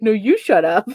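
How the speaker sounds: mock retort through a laugh; playful friend laughing back. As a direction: toss it back while laughing. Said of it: "She's like".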